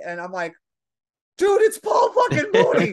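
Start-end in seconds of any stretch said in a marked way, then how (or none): 1.4-2.9 high, loud fangirl yell; freaked-out shout. Retold or imitated. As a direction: shout it in starstruck disbelief, much higher. Freaking out at friend, retold.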